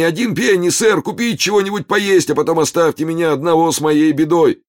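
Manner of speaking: высоким громким надрывным голосом; изобразить страдальца, выпрашивая пенни; притворный нищий в припадке. Pretend. Гуга изображает припадочного нищего. (высоким громким причитанием, как мнимый больной)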